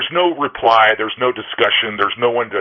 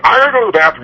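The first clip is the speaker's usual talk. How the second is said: mock polite excuse; 8 semitones higher, 6 dB louder, much faster than usual. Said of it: "and you go".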